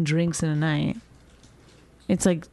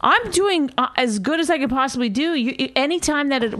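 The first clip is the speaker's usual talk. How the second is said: pitched up, indignant comeback; defensive protest; 7 semitones higher than usual. Real, not acted. Wife answers husband's criticism of the podcast, defensive. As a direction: push back, stung by the criticism. Bickering over how the podcast goes.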